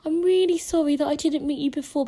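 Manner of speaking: high sugary midwife greeting; imitating the midwife. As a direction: put on a high syrupy nice voice. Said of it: "she's like".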